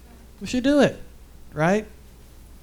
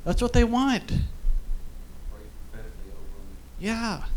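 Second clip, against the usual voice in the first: emotional; slower than usual. Real, not acted. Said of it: "I was not gonna get emotional".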